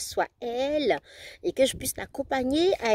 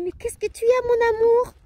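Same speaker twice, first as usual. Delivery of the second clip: tender question; 11 semitones higher, 4 dB louder than usual. Retold or imitated. Mother answers crying son.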